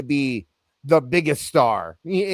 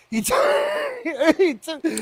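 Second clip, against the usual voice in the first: laughing; 12 semitones higher than usual. Real, not acted. Breaks up laughing mid-sentence.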